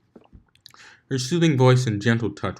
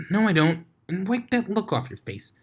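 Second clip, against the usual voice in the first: much higher, giggly pony retort; snickering reply; 11 semitones higher than usual. Acted. Pinkie snapping back at Applejack, high and snickering. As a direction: snicker through the denial. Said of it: "snickered the sound".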